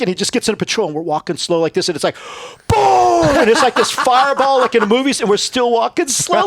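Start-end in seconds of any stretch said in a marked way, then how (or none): 2.7-3.2 a loud, high-pitched boom; imitated explosion boom. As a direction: burst out with the sound of the blast. Explosion retold.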